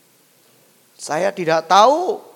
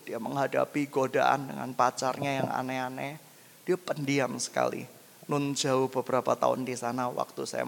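low, soft anecdote voice; quiet, low-pitched narration; hushed storytelling; 9 semitones lower, 7 dB quieter than usual. Acted. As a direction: drop right down to begin a story. Preacher quietly begins an anecdote.

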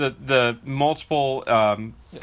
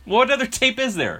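laughing disbelief; 9 semitones higher than usual. Real, not acted. Laughing incredulous question.

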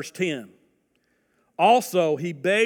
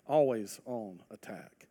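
"always on attack" repeated softer and lower; hushed repeat of "always on attack"; quiet echo; 6 semitones lower, 5 dB quieter than usual. Shown as a demonstration.

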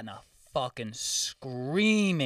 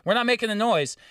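retold shouting; 8 semitones higher than usual. Man tells off neighbour at two thirty, retold shouting. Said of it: "I was screaming at".

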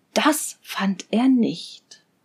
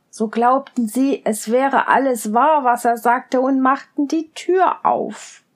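ordinary delivery

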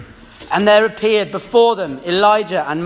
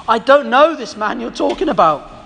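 higher-pitched, mildly heated denial; a touch of angry protest; swearing denial; 5 semitones higher than usual. A narrator's reading. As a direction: deny it with an oath, pitch raised. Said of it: "He began to call down curses".

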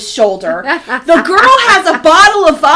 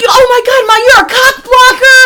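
high, whiny-loud protest; imitated outraged teen; 8 semitones higher, 5 dB louder than usual. Teenager accusing mother of spoiling things, imitated outrage.

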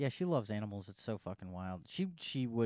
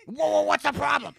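loud, high-pitched mock protest; hammed-up alarm at being yelled at; imitating taken-aback ma'am; 11 dB louder than usual.

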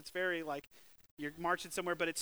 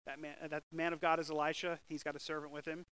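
ordinary talk

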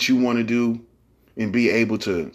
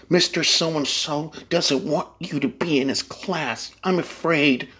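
prim manager voice; 6 semitones higher, slower than usual. Retold or imitated. Mocking a polite manager.

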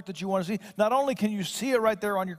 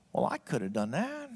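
lightly voiced unimpressed gallery visitor; a touch of mock dismissal; mildly dismissive; 5 semitones lower, 4 dB quieter than usual. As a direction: imitate a sceptic in a lower voice. Imagined viewer dismissing a painting.

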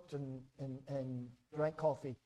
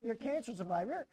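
mock scolding; 6 semitones higher than usual. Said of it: "pick on my brother".

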